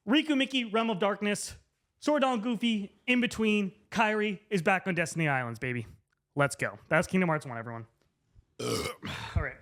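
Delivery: usual speaking voice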